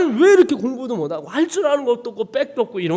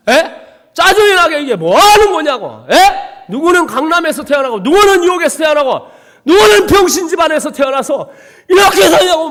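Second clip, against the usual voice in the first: high, loud, angry yelling with a bitter laugh; loud bitter outcry against God; furious rant at God; 9 semitones higher, 11 dB louder than usual. Retold or imitated. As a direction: rage at heaven, shouting. Raging at God in bunker.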